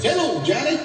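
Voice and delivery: high, swooping mock-friendly hello; cheery reverend greeting. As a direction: greet the caller in a high imitated singsong. Reverend answers threat cheerily.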